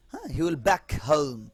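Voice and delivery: usual speaking voice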